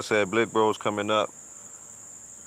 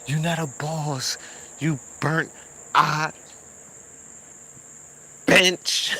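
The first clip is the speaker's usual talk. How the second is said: skit quote; 8 semitones higher, slower than usual. Retold or imitated. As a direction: replay the skit line, pitched up and building to a shout. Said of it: "that Dame Dash skit y'all did".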